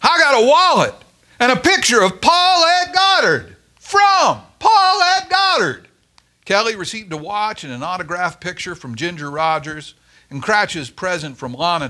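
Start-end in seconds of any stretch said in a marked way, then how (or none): 0.0-5.9 very high, loud shrill voice. Acted. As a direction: stay up high and louder. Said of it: "said Koenig shrilly".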